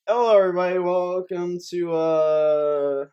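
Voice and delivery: loud, drawn-out welcome; big show greeting. Pretend. Host opening the show to everybody, loud drawn-out welcome. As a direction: belt out the intro, stretching the words.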